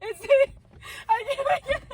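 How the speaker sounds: high, squeaky gasps of laughter; helpless laughter. Real, not acted. Reader giving up on the story, helpless laughter. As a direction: give up, too giggly to speak.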